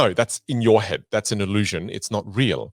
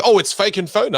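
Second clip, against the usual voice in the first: a light hammed-up student voice, higher; playing the doubting student; mock student; 9 semitones higher than usual. Teacher imitates a student who calls it fake, higher. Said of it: "you go".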